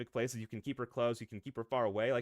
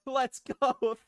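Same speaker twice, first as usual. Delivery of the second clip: laughing cheer; 12 semitones higher, 7 dB louder than usual. Real, not acted. Cheering at viewer support.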